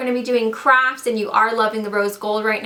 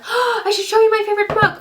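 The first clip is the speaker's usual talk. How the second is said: excited gasp; 10 semitones higher than usual. Real, not acted. Sudden idea, gasps.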